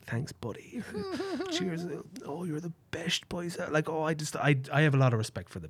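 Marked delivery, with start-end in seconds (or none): 0.1-3.6 soft mock friendly thanks. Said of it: "I double blink him like".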